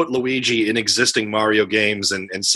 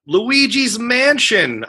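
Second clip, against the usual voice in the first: drawn-out high game title call; announcer voice; 11 semitones higher, much slower than usual. Shown as a demonstration. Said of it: "my own beatbox, uh, impression".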